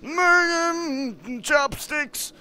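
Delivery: high shouted nonsense war cry; gibberish battle cry. Acted. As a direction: scream the nonsense words like a charge.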